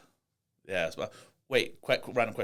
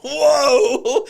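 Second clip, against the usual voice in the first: very high, loud cry of surprise; shocked whoa; 17 dB louder than usual. Real, not acted. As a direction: burst out in delighted shock.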